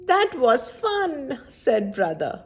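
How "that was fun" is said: high, brighter thrilled cry; happy exclaim. A narrator's reading. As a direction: beam it out. Brother after his ride.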